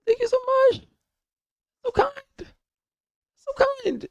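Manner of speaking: extremely high, squeaky tearful voice; overcome, tiny high-pitched gratitude; about to cry. Real, not acted. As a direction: squeak it out through happy tears. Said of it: "I'm gonna cry".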